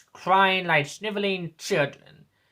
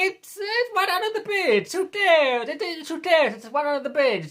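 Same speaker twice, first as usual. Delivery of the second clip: much higher, mocking sing-song; mock dismissive lefty; 11 semitones higher than usual. Mocking the left shrugging off war, in a high put-on voice.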